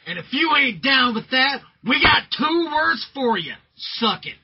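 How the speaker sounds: loud, high arena yell; catchphrase shout. Acted. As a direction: bellow it to the crowd.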